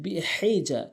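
"বিহিজা" with strong mid-throat ha; big ha. Shown as a demonstration. Teacher correcting learners, "বিহিজা" with pharyngeal ha. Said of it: "হলকের মাঝখান থেকে".

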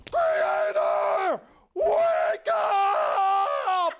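loud pleading call to wake him; frantic wake-up shout; urgent shout. Acted. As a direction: yell to rouse the sleeping creator. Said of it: "He said".

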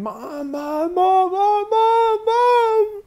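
rising, desperate high scream. Retold or imitated. Child screaming into the foyer for mother. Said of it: "and I'm screaming".

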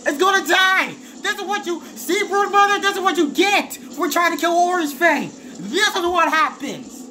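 high, frenzied gloating; exultant shouting. Real, not acted. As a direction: crow at the beaten enemy, voice way up high. Gloats at the dying boss.

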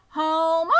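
loud high shout of a name; cried out. Acted. Farmer's wife yells for Homer, loud high shout. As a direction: call the man across the farm. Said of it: "she cried".

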